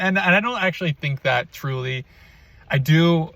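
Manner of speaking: normal talking voice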